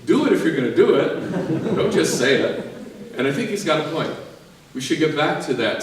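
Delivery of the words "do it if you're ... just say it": retold scolding, raised and higher; mock indignant priest, pushing louder; upset priest. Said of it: "a priest who got really upset".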